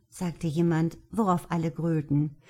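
ordinary delivery

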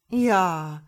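drawn-out pondering yes, loud; thoughtful. A narrator's reading. Starling answers the upset mother, thoughtful. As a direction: stretch the word while thinking it over. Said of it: "erwiderte der Star nachdenklich".

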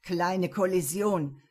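slow, laughing mockery; theatrical giggle. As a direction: giggle it out like on stage. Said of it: "kicherte John mit bühnenreifer Stimme". (theatralisch kichernd)

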